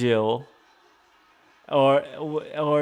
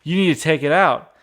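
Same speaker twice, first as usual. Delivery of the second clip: louder, fast, half-hearted threat; retold wife ordering the husband to remove the dishwasher, mild mock threat; 2 semitones higher, 7 dB louder, faster than usual. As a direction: snap it out as a retold warning. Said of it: "threatens and says".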